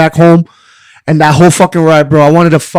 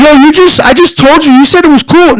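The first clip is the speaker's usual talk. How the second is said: raised, higher-pitched exasperated protest; heated protest; 8 semitones higher than usual. Retold or imitated. To the boss on the phone, exasperated and raised. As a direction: push back hard, voice climbing in disbelief.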